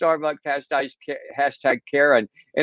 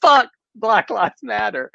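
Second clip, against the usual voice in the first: high mock shriek; shrieked insult; 9 semitones higher than usual. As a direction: fling the insult high-pitched as you rush back in. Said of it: "she comes flying back in".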